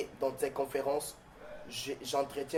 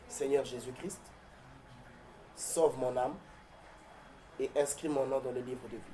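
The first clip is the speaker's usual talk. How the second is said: led prayer; 8 dB quieter than usual. Acted. Leads viewers in a prayer.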